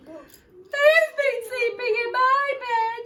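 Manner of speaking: very high, tearful little cub; Baby Bear. Acted. Baby Bear finding his bowl empty, high tearful wail. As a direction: wail it like a tiny upset bear. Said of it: "said Baby Bear".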